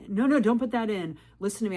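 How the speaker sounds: mock his pushy order to listen; bossy demand. Man ordering her to listen.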